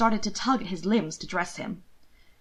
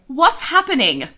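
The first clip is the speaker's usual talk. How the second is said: louder, higher bewildered question; confused, raised voice; 6 semitones higher, 8 dB louder than usual. Acted. Confused brother calling out amid bustling maids. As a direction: demand to know what's going on. Will confused, asking loudly.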